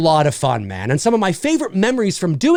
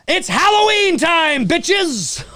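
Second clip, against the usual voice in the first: slow, higher, hyped holiday shout; excited shout; 9 semitones higher, much slower than usual. Real, not acted. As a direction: yell it out like a party starting. Halloween kickoff hype.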